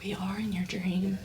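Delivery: hushed, amazed whisper; awed whisper. Retold or imitated. Friend realizing they are inside her dream, awed whisper. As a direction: breathe it out in wonder.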